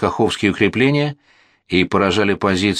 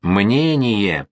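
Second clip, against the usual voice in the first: drawn-out bark, restrained in volume; barked demand. A narrator's reading. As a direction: bark the word into a deaf man's ear, stretching it. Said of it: "гаркнул генерал в левое ухо пушкаря". (гаркнуть, растягивая слово)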